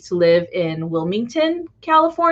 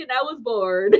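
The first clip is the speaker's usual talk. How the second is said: ordinary talk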